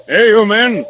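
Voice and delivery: loud, high call across the snow. Acted. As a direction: shout ahead to the sled drivers. Calling out to sled drivers.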